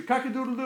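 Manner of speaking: playful cock-a-doodle-doo; rooster crow. Pretend. Parent naming the rooster, then playful crowing. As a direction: crow it out for a toddler.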